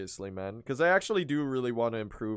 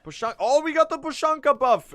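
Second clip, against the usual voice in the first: high, loud, overjoyed shout; excited outburst; 14 semitones higher, 8 dB louder than usual. Real, not acted. Streamer cheering the buff to chat, loud and high. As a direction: burst out as the lucky buff lands. Lucky buff appears mid-run.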